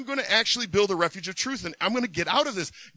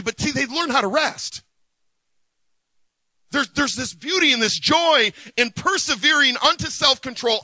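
full-force preaching; 5 semitones higher, 5 dB louder than usual. Acted.

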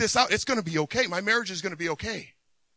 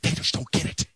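grumbling aside. Retold or imitated. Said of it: "And you're like".

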